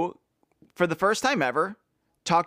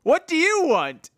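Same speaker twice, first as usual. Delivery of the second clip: high, hammed-up angry demand; 10 semitones higher, 3 dB louder than usual. Man to boys bursting in, mock angry demand. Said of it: "demanded the man angrily".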